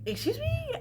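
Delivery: high squeaky baffled question; mock confused boyfriend. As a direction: squeak it out in disbelief.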